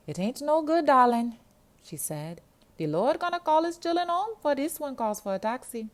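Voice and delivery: put-on a sassy Jamaican girl giving up on the phone; weary Jamaican girl. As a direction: sigh it out in a big, high Jamaican voice.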